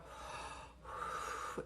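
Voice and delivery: long slow breath out, unvoiced; deep calming sigh. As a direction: show a patient steadying exhale. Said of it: "This is how you deal with her".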